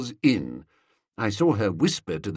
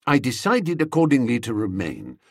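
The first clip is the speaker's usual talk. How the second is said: usual speaking voice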